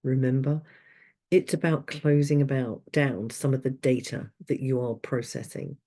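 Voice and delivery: ordinary talk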